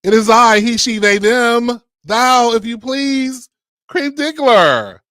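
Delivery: high, slow, grandly announced self-introduction; high, slow, mock-regal stage entrance; grand host entrance. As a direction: announce yourself like royalty. Grand show opening introduction.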